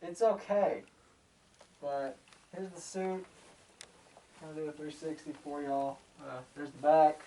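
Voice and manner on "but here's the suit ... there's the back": muffled inside a helmet. Real, not acted. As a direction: talk from behind a helmet visor. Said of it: "my voice muffled".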